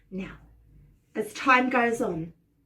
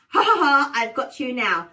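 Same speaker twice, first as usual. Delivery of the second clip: light demo of a witch's laugh; witchy laugh; 2 semitones higher, 6 dB louder than usual. Shown as a demonstration. Showing everyone the line to copy, light witchy laugh. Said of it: "with a lovely witchy laugh".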